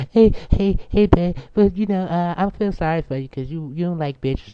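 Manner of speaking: high-pitched mock female voice; imitated woman. Woman on the street pitying the speaker, high imitated voice. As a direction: start way up high, settle slightly as she goes on. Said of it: "she up there talking about some".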